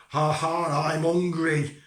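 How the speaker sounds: loud, booming storybook wolf; hungry wolf. Acted. Big wolf about to gobble Tom, loud booming roar. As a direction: bellow it as a greedy villain.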